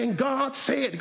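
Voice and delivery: high, chanted sermon phrase; imitated preacher tuning up. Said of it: "when you tune up".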